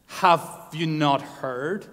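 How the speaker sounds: drawn-out rhetorical question; "Have you not heard" slowed right down; slow emphasis. Acted. Preacher pressing the question, very slow.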